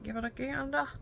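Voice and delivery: high-pitched Southern lilt; hammed-up Southern voice, pitched high; mock Southern accent. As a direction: play up a snooty Southern gander. Repeats gander in mock Southern voice.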